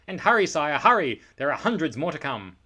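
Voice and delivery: put-on loud, hurried urging from an old lord; urgent call. A narrator's reading. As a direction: hurry the king along, pressing hard. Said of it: "old Droon would say".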